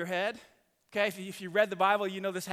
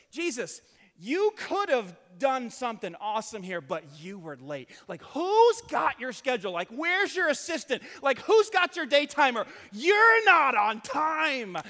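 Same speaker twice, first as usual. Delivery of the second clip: louder and louder, indignant complaint; mock scolding; 8 dB louder than usual. Pastor voicing Martha scolding Jesus for being late, indignant mock rant. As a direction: scold him like a late employee.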